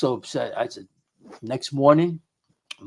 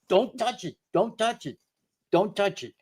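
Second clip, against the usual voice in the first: higher, alarmed repeated warning; retold frightened plea, pitched higher; urgent warning; 7 semitones higher, 3 dB quieter than usual. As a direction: stop her in a rush of alarm. Stopping wife touching moving planchette.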